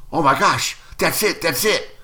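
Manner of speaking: high-pitched excited realization; sudden eureka. Retold or imitated. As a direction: blurt out a breakthrough idea. Reenacts his sudden script idea.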